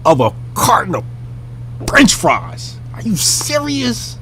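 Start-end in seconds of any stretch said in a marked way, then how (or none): 2.9-4.2 high, slow, soft disbelief; incredulous. Real, not acted. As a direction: stretch it out like you can't believe it. Incredulous at petty manager.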